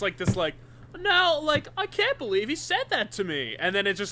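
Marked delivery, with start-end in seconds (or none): 1.0-3.6 high, whiny complaining; whiny victim voice. Retold or imitated. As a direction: play the hurt victim. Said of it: "it starts out just".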